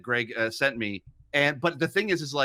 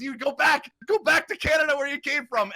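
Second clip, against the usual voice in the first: high mock shout of a host banishing a guest; Greg's scolding tone; 12 semitones higher than usual. Guest imitates host sending him home, high scolding shout. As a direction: order them off in exaggerated outrage. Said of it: "hear Greg telling you that in that exact tone".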